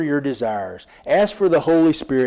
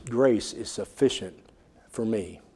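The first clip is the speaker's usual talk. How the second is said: quiet; 4 semitones lower, 7 dB quieter than usual. Pretend. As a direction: let the words sink down softly. Prayer softens to surrender.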